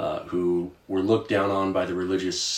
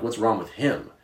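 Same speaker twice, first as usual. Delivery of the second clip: ordinary delivery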